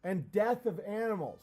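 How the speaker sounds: usual speaking voice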